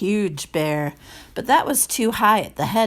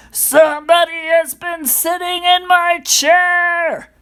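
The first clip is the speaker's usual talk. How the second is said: loud, high-pitched gruff bear voice; great huge bear; 11 semitones higher, 8 dB louder than usual. A narrator's reading. Big bear finds his porridge touched, loud gruff voice. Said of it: "in his great rough, gruff voice".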